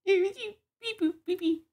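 robot beeps. Acted. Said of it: "your Terrence".

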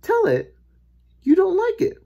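high, loud instruction; insistent boy. A narrator's reading. Boy instructs lady urgently.

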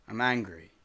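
ordinary talk

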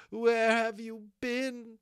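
high, loud, drawn-out outraged cry. Acted. Molly confronts the long-awaited unicorn, crying out in outrage. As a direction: cry it out slowly, every word pushed hard. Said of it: "she cried".

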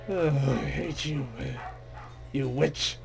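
usual speaking voice

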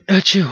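sudden real sneeze, high and loud. Real, not acted. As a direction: sneeze out loud, mid-sentence. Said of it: "I sneezed".

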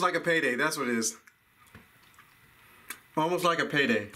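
chewy muffled speech; talking while chewing. Real, not acted. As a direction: mouth partly full of candy. Seen 3: Bites candy at -3; pursed, working lips while talking after.

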